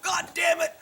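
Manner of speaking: high-pitched put-on indignant complaint; hammed-up cop grumbling, pitched high throughout; mock griping cop. Campus cop back at station griping, mock indignant voice.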